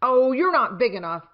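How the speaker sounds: a grouchy little bug waving off a rival, loudly; grouchy ladybug. Acted. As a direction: scoff at the bigger bug, loud and proud. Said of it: "said the grouchy ladybug".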